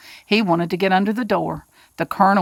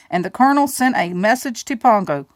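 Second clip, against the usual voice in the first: ordinary delivery, nothing marked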